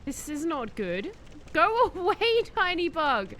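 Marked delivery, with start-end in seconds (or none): 1.5-3.3 laughing shout. Real, not acted. Laughing at the glitched bug.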